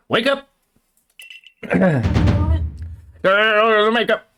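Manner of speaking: calling out to wake. Acted. Professor shouting at the fallen player to wake up. Voices game character waking player.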